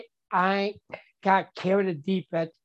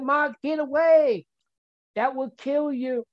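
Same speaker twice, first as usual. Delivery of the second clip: high, urgent warning, voiced higher; 6 semitones higher than usual. Friends warning Mark from the bakery on the phone, urgent and high. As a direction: sound the alarm for a friend. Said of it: "they said".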